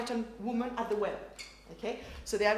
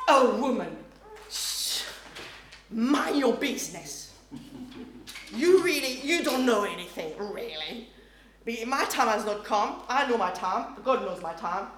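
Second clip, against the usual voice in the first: loud, scolding, dismissive; harsh rebuke; 4 dB louder than usual. Retold or imitated. Jesus rebuking his mother the wrong way, loud harsh scolding. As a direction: snap at her to mind her business. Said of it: "I read it like that".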